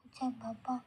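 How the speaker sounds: usual speaking voice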